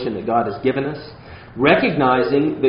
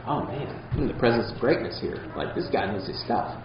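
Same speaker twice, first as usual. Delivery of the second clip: hushed, softer mock admiration of a famous teacher; awed fan; 3 semitones lower, 10 dB quieter than usual. Preacher mimics a starstruck conference-goer, hushed and awed. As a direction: play the starstruck churchgoer, softly. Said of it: "And it's like".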